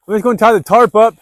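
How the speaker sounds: almost shouting the plan out; loud outdoor call; yelling. Real, not acted. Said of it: "I don't know why I'm yelling".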